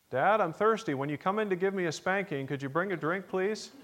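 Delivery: put-on a small boy sweetly bargaining from bed; child calling out.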